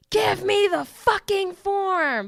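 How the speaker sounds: mock irate yell, high, loud and drawn out; imitated angry yell. Podcaster acts out an irate customer at the post office, yelling. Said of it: "starts yelling at him".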